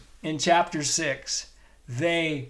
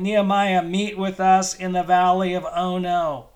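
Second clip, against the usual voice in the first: louder, higher, hammed-up luring call; mocking enemy invitation; 4 semitones higher, 4 dB louder than usual. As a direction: tempt him down with a sing-song invitation. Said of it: "they're saying".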